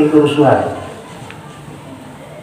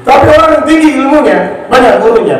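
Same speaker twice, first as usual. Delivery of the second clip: preaching emphasis; 10 semitones higher, 4 dB louder than usual. Real, not acted. Preacher rising to a point.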